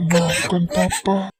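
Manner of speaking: loud, very slow drawn-out apology; anguished cry. Acted. Father crying out his last apology, anguished cry. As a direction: stretch each word in grief.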